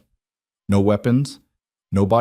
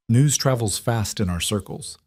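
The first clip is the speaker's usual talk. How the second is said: plain reading voice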